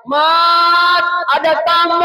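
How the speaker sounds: high mock call across the house; wife calling out. Wife calling her husband by name to the door, high mock yell. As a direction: holler for Mat like a wife at home. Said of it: "ada dilihat teriak".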